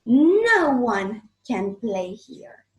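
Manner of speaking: loud, slow and stern; angry giant. Acted. Giant claiming his garden from the kids, stern and loud. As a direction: lay down the law to the children. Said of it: "says the giant".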